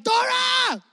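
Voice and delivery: put-on high shouted kid call; kid shouting. Kid shouting at Dora on TV, high. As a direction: yell at the TV like a child. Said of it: "as a kid were like".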